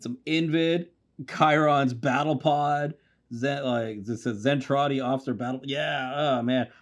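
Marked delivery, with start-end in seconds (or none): none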